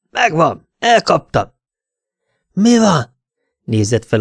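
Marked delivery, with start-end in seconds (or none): 0.1-1.5 higher-pitched cry of triumph; triumphant exclamation. A narrator's reading. Detective bursts in on the lovers, triumphant cry. 2.5-3.2 high, loud, startled question; startled exclamation. A narrator's reading. Man in bed is caught by intruder, startled outburst.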